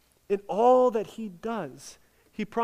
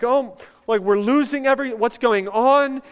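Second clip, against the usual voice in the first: louder, pleading cry of the distressed faithful; mock crying out; 6 semitones higher, 8 dB louder than usual. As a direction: cry out like someone losing everything. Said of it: "crying out".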